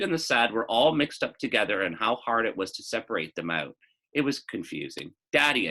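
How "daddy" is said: loud call to dad down the hall. A narrator's reading. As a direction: call out for daddy from bed. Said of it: "I shouted".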